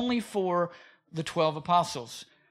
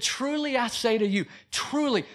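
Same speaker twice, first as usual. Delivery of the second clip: "truly" repeated and hit hard; stress on truly; 5 semitones higher than usual. Shown as a demonstration. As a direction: lean into "truly" with a falling tune. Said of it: "He's emphasizing how true this is".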